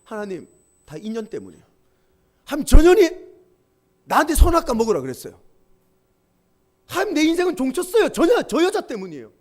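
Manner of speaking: a husband blaming his wife before God; blaming Adam. Acted. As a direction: point the finger and shout at God about her. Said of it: "자기 부인 하와를 향해 삿대질을 합니다".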